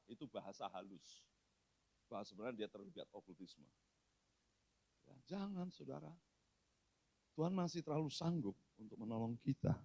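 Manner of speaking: hushed preaching. Acted. Pastor confides a warning to the church, very soft. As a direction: drop to a near hush.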